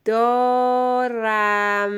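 دارم hugely stretched; slow dictation. Shown as a demonstration. Teacher dictates for a pupil writing, very drawn-out. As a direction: hold both vowels and final m of دارم.

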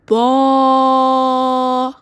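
با held long and loud; stretched dictation. Shown as a demonstration. Teacher dictating to young pupils, stretching با loudly. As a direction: draw out با for the class. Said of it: "صدا کشی یادت نره".

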